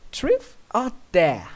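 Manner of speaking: high, slow teasing princess voice; playful princess. A narrator's reading. Luna challenging you in bed, slow and high. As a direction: draw it out, daring him. Luna asks game question.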